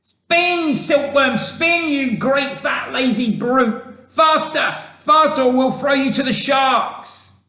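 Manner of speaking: high, loud urging shout; impatient high-pitched yell. A narrator's reading. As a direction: bellow orders down at a lazy worker.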